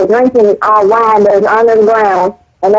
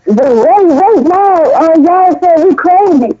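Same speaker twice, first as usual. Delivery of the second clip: mock retort; 7 semitones higher than usual. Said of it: "And y'all like".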